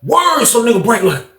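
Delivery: ordinary talk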